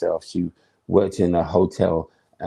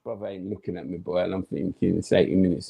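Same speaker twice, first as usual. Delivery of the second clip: ordinary talk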